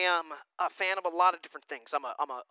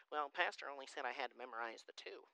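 softer, lower voice of a reluctant pupil; mock excuse-maker; 4 semitones lower, 14 dB quieter than usual. Pastor imitating member dodging extra memorizing, mock reluctant. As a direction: lightly mimic a half-hearted excuse.

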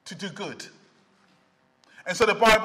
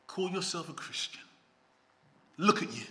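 much softer and lower, a sly accusing taunt; devil whispering; 6 semitones lower, 11 dB quieter, faster than usual. Retold or imitated. Preacher voicing the devil's taunt to a believer, hushed. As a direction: murmur the accusation close in the ear. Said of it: "he whispers in your ear".

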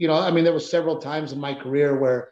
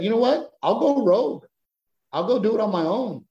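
higher, punchy declaration of going it alone; defiant resolve; 7 semitones higher than usual. Retold or imitated. Comic deciding to quit Hollywood's box, fired-up defiance. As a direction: announce it like a rallying cry. Recalling his own defiant decision.

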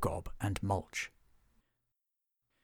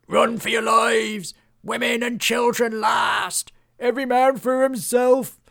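panicked screaming; 12 semitones higher, 10 dB louder than usual. A narrator's reading. Fleeing villager screams warnings to everyone in panic. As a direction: scream it as you flee.

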